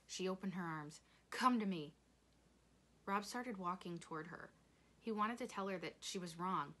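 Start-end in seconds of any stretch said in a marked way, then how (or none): 1.3-1.9 loud, urgent beckoning; calling them in. A narrator's reading. Willie Mae opening her arms, calling them in. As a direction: summon them into your arms.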